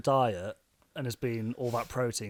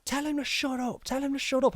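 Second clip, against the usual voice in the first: much higher mock voice of angry punters; imitating other customers; 12 semitones higher than usual. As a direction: squeak it like a pub crowd heckling. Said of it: "there's people at the other tables like".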